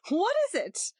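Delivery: high startled question; surprised. A narrator's reading. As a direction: sound caught off guard. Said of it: "in a rather surprised voice".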